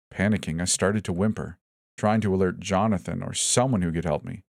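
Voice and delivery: ordinary delivery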